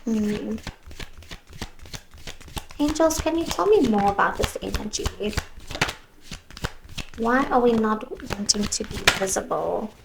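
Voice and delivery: ordinary talk, nothing marked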